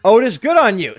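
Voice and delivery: ordinary talk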